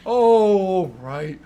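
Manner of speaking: loud, grumbling assent; grudging give-in. Acted. Bastian grudgingly agrees to get dressed. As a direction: give in with a sigh. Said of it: "And he's like".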